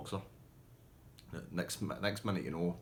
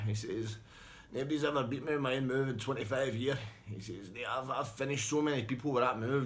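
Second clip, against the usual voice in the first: gracious loser; 6 semitones higher than usual. Retold or imitated. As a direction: raise a glass, cheering the winner. Defeated man toasting winner.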